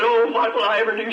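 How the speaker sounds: crying out in grief; anguished cry. Retold or imitated. Husband crying out at his dying wife's bedside.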